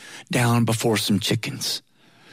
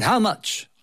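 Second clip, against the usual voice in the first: blunt, higher "How much?" straight to the point; blunt question; 8 semitones higher than usual. Retold or imitated. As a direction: ask "How much?" flat out like a bargainer. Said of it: "that's not the way you ask about real estate".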